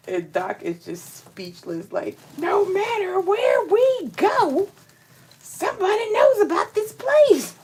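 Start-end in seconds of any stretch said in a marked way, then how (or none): 2.4-7.5 high, loud astonished exclamation; amazed disbelief. Retold or imitated. Doc stunned that everyone knows Aliquippa, high amazed cry. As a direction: throw it up high like you can't believe it.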